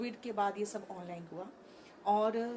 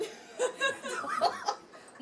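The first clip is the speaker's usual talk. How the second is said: हँसी; 4 dB louder than usual. Real, not acted. Anirudh महाराज की बात पर हँसी.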